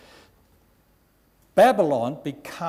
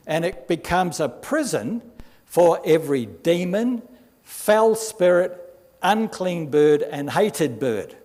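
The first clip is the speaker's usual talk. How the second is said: loud, slowed, higher proclamation; fiery pulpit reading; preaching scripture; 4 semitones higher, 6 dB louder than usual. Acted. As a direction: spit out each foul creature. Reads Revelation with force.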